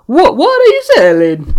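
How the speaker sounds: loud, high-pitched mock Italian; over-the-top failed Italian accent; bad Italian accent. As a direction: belt it out in a hammy Italian accent. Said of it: "Nah, that Italian doesn't work".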